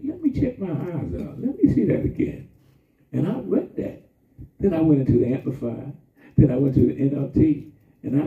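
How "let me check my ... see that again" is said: retold amazement. Retells amazement reading scripture.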